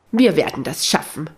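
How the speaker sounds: plain reading voice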